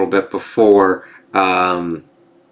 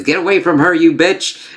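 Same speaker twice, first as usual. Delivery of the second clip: quoting Aliens; 8 semitones higher than usual. Retold or imitated. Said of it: "utilizing the line from Aliens".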